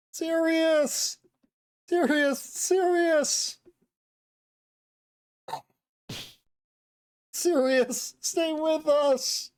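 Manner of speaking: high, drawn-out cry of a name; loud calling out. Acted. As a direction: call someone back from far away. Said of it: "shout over and over with that stupidly loud voice".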